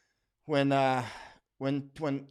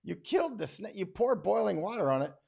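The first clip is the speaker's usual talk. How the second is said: higher, incredulous questions; mock shock; 6 semitones higher than usual. Speaker reacting to Viola killing the snake, surprised. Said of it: "I was like".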